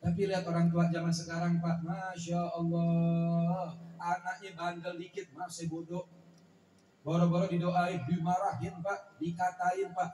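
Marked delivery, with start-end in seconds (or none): 1.9-3.8 drawn-out dismay. Acted. Dismayed exclamation about modern parents.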